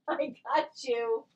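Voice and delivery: a gentle high coo to the caught dog; pet talk. Real, not acted. Catching the dog.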